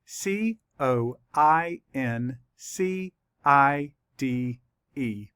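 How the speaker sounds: very slow, spaced-out letters; letter by letter, leaving time to write; spelling for dictation. Shown as a demonstration. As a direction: spell slowly, pausing after each letter. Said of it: "Let's write it".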